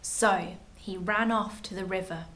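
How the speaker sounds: usual speaking voice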